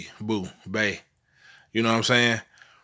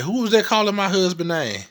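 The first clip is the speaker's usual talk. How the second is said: startled wife; 9 semitones higher, 4 dB louder than usual. Retold or imitated. Wife alarmed by ghost voice.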